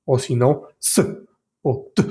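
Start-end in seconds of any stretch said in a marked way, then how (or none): 0.8-1.1 "s" as a bare consonant, no vowel; wrong version: only the sound "s"; consonant alone. Shown as a demonstration. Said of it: "no podemos simplemente pronunciar la consonante". 1.9-2.0 "t" as a bare consonant, no vowel; wrong version: only the sound "t"; consonant alone. Shown as a demonstration. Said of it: "no podemos simplemente pronunciar la consonante".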